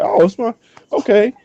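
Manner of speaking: high, surprised backing off; taken aback. Retold or imitated. Answering the birthday girl, high surprised backpedal. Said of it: "I was like".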